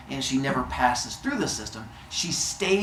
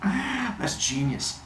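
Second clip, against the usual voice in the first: dopey awe; 8 semitones higher, 4 dB quieter, much slower than usual. Retold or imitated. Brain-addled Zeus approving.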